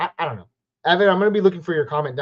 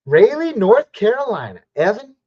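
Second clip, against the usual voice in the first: playful, exaggerated North Carolina twang; North Carolina accent; 5 semitones higher, 6 dB louder than usual. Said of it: "decided to talk with an accent".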